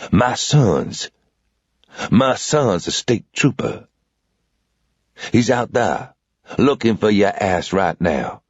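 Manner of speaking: put-on old southern man. A narrator's reading. Old man holding a revolver on the intruder, slow drawl. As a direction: drawl it out, cool and unhurried.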